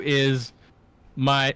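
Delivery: ordinary talk, nothing marked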